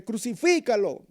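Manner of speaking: one cry, pushed harder. Retold or imitated. Preacher pushing the mob's cry stronger, loud. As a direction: push it even stronger. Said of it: "más fuerte".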